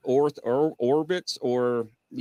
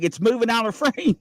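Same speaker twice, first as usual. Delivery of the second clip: high-pitched comic outburst; retold realization; 10 semitones higher than usual. Father telling daughters what went wrong, retold realization. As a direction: deliver the discovery as a high-pitched punchline. Retold punchline of telescope story.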